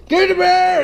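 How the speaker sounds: high, loud toast yell; shouting. Real, not acted.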